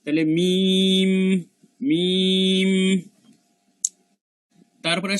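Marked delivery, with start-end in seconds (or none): 0.3-2.7 মিম drawn out, humming on the final m; lips-closed mim. Shown as a demonstration. Teacher showing lips meeting, long humming mim. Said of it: "ঠোঁট দুইটা মিশে যাবে".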